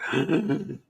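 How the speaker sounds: shuddering gasp, softly acted; imitated gasp. Possessed woman as the evil departs, imitated shuddering gasp. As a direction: mimic a quivering sharp intake of breath. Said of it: "she gasps in".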